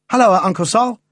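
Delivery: high, loud hail to an uncle; bright boy's halloo, loud and high; boyish greeting call. A narrator's reading. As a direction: call out a cheery hello, pitched high. Boy hails his uncle on arriving.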